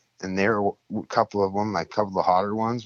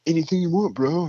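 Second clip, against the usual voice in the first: much higher, slick dealer pitch; street dealer; 9 semitones higher than usual. Retold or imitated. Dealer on a bike pitching drugs to the newcomer, higher and slick. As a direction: sell it like a friendly hustler.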